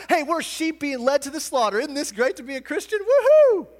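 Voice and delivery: fast, ironic chirp ending in a huge whoop; mock the slogan with a shrieking high cheer; sarcastic mock cheer. Pastor mocking a t-shirt slogan, sarcastic whoop. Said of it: "you just wanna put this on a t-shirt".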